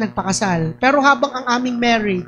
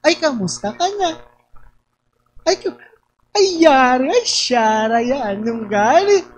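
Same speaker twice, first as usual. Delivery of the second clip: Lucban way of speaking. Shown as a demonstration. Showing how Lucban folk talk, Lucban lilt. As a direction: demo of the Lucban sing-song with ay. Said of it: "paano magsalita ang lukban?".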